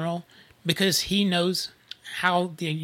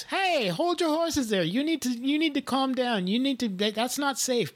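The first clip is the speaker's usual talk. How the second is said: Irish Uber driver; 6 semitones higher than usual. Retold or imitated. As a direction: mimic the driver scolding gently from the window. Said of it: "He goes".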